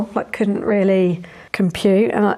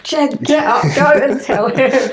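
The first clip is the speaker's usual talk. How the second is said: high, loud urging call; excited urging; 6 semitones higher, 4 dB louder than usual. Retold or imitated. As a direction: push him to go, pitched up. Retold urging, voice raised.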